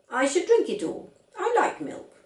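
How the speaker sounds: put-on high, bright child voice, firm; a small stubborn girl correcting her dad; voiced little girl. A narrator's reading. Little girl contradicting her father, high and firm.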